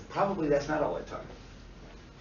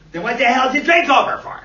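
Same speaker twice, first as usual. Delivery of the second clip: loud, high-pitched outburst; shouted, judgmental demand; exasperated idiot counselor; 9 semitones higher, 13 dB louder than usual. Acted. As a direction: yell it in disbelieving exasperation. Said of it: "That's a stupid idiot counselor question".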